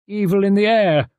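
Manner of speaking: high little detective voice, muttered to himself; muttered Poirot. A narrator's reading. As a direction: mutter it as the fussy foreign detective. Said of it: "muttered Poirot".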